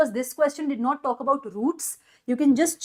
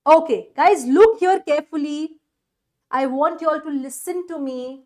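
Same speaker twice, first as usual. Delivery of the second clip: louder and higher, grabbing the class; calling for attention; 4 semitones higher, 6 dB louder than usual. Real, not acted. Teacher calling the whole class to attention, loud. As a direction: call out to pull everyone's eyes up.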